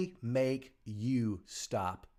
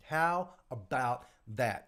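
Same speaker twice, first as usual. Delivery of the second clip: "How about that" drawn out slowly; slow emphasis; 2 semitones lower, slower than usual. Real, not acted. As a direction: spell out "How about that" word by word, unhurried.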